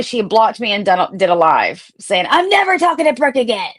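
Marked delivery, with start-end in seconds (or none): 2.3-3.7 high, fast, sing-song mimicry; sneering impression of the friend's dramatic vow; mocking parody. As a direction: rattle it off much higher as a snotty parody. Mocking Jules's live video.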